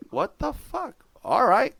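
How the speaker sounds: high, stunned outburst; shocked disbelief. Retold or imitated. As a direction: blurt it out in disbelief. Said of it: "I'm like".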